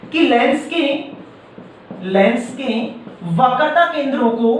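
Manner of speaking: slow, broken into short chunks with pauses; slow dictation. Shown as a demonstration. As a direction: dictate the definition word by word. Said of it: "तो परिभाषा क्या लिख सकते हैं".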